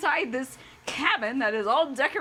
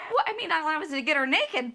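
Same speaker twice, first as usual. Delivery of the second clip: ordinary delivery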